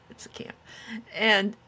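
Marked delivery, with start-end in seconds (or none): 0.1-0.6 whispered aside. Real, not acted. Aside correcting herself.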